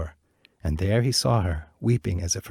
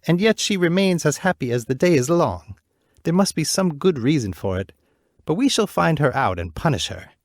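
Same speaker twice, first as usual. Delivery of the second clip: higher, louder scheming queen; wicked queen; 7 semitones higher, 4 dB louder than usual. Acted. Queen plotting against the princess, louder and scheming. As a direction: vow to catch her out. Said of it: "said the queen".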